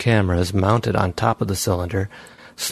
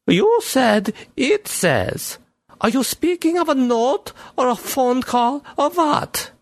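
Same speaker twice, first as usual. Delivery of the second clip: much higher, questioning woman voice; police chief voice; slower than usual. Acted. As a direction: skeptical chief, raised pitch.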